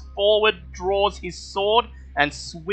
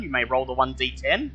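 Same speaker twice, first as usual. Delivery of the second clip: normal talking voice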